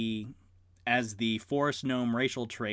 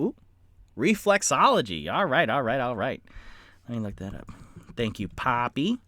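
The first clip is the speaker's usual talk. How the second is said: ordinary delivery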